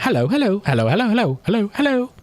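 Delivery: high squeaky villager greeting on repeat; talking villager. Retold or imitated. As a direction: chirp hello like a Minecraft villager. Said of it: "And he'd walk into the room".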